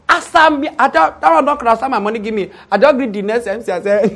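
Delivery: ordinary delivery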